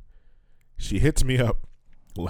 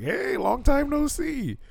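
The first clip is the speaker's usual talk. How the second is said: very high, slow sing-song; mock casual greeting; slower than usual. Mocking her sudden hello, high slow sing-song. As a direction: drawl it like a fake-friendly hello. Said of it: "hit him up like".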